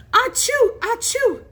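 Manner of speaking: two high, loud fake sneezes; mimicked sneezes. A narrator's reading. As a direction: sneeze twice theatrically. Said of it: "Joe sneezing".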